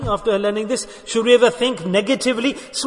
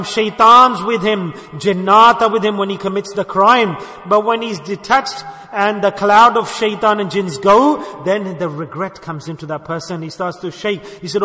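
usual speaking voice

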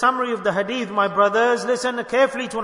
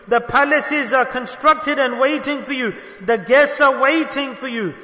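rising, louder preacher's promise; fervent preaching; 5 semitones higher, 5 dB louder than usual. Acted. Preacher voicing the angel of death's promise, fervent. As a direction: proclaim the reward with building fire.

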